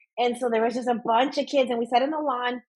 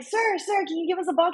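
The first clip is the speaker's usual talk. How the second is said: put-on high-pitched little boys begging; pleading kids; 8 semitones higher than usual. Mom voicing kids asking a pitcher, high plea. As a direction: imitate kids pestering politely.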